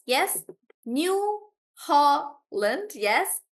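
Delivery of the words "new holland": "New Holland" very slow and higher, New-Hol-land; syllable by syllable. Shown as a demonstration. Teacher confirming a student's example, syllable by syllable. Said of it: "we break the word into syllables".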